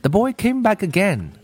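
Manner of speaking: measured storybook reading with lilting pitch; story read-aloud. A narrator's reading. Teacher reading a story for listening practice, storytelling. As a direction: read it like a gentle picture-book tale.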